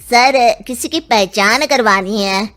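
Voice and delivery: constable down a phone line. Acted. Constable calling the father to the police station, phone voice. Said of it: "थाने से सुल्तान सिंह को phone आता है".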